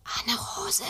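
urgent hushed whisper. Acted. Frightened boy waking his sister in a whisper. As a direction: whisper her name to wake her. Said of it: "flüstert Schnüpperle".

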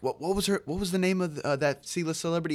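high-pitched imitation of a prompting improv teacher; teacher in character. Teacher putting a frozen student on the spot, high imitated questioning. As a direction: pitch it well up, playing the questioner.

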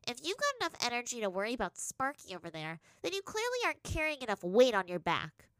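bossy, high-voiced reprimand; taunting taskmaster. A narrator's reading. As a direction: needle the pupil for slacking. Teacher taunts pupil, adds weight.

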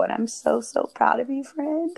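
teary. Real, not acted. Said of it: "I'm all getting all teary".

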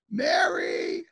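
very high, drawn-out call without shouting. Retold or imitated. Older woman calling away from the phone, high call. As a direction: imitate a woman calling someone in the house. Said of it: "calls out away from the phone".

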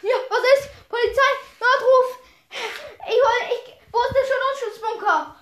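high, loud panicked yelling; bellowing. Acted. Sandy woken, looking for the bunker, high alarmed bellow. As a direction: yell as if jolted awake in an emergency. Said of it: "brüllte, brüllte Genie".

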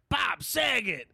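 high, slow blurted name; imitating Tourette's guy. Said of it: "he just would say".

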